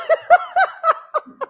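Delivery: high, loud delighted laugh. Real, not acted. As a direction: burst out laughing in happy disbelief. Laughing at extra panel offer.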